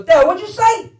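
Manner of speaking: high-pitched startled question; disbelieving son. Retold or imitated. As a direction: call back to Dad, not believing your ears. Son calls back to shouting father.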